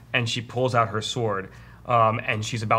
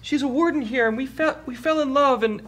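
high falsetto confession, building louder; Snoo Snoo; 5 dB louder than usual. Acted. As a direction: blurt out the secret as the frail orc. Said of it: "he talks to you".

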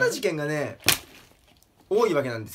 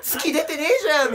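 despairing complaint; 12 semitones higher, 5 dB louder than usual. Acted. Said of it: "みたいな感じで".

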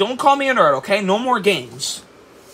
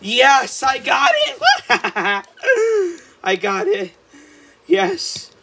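higher, laughing victory cries; triumphant; 5 semitones higher, 4 dB louder, slower than usual. Acted. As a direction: crow in delight at the catch.

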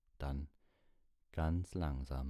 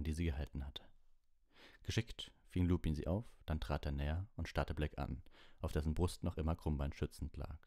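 ordinary delivery, nothing marked

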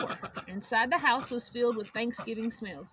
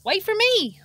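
calling out; 9 semitones higher, 9 dB louder than usual. Acted. Sheep calls after them.

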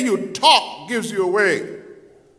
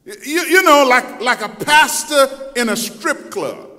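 ordinary delivery

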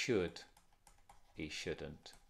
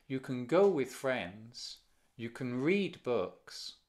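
slow, with "go" and "read" stressed; slow down and lean on each verb; slower than usual. Shown as a demonstration. Teacher demonstrating intonation to students, slow with stressed verbs. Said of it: "Note dónde va la entonación".

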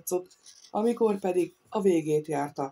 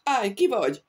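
louder sentry's challenge into the dark woods; calling out; 4 semitones higher, 4 dB louder than usual. A narrator's reading. A guard with a musket calls out into the forest. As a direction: call the challenge out, a beat too late. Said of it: "elkésve kiáltotta be az erdőbe".

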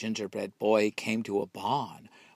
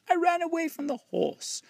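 much higher singsong line; taunting squeal. A narrator's reading. Gingerbread boy boasts to the fox in a high squeal.

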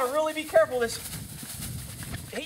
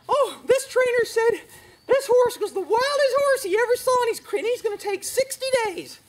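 high, loud whiny mock of a naive owner; mocking gullible owner; 12 semitones higher, 6 dB louder than usual. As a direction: ham up the gushing client. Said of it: "you're like".

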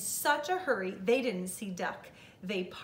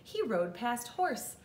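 ordinary delivery, nothing marked

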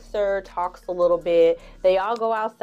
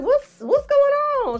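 high, loud concerned question; concerned roommate; 6 dB louder than usual. Retold or imitated. Roommate finding Corinne upset, high and alarmed. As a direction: sound alarmed for her. New York asks Corinne.

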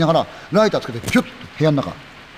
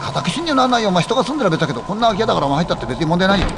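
家宅侵入 retorted much higher, brushing it off; high scoffing reply, 家宅侵入なんないよ; scoffing friend; 6 semitones higher than usual. Acted. Friend dismisses trespass worry.

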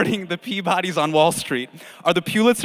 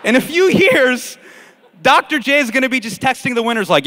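usual speaking voice

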